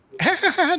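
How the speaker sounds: high giggling laugh mimicking Reggie; imitated laugh. Host mimics Reggie laughing live on air, high mock giggle. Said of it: "Reggie was laughing".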